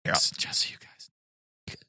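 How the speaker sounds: whisper. Pretend. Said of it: "keep whispering".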